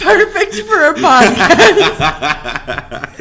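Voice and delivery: slow, loud sarcastic laugh. Real, not acted. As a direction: drag it out, deadpan sarcasm. Sarcastic about sign language.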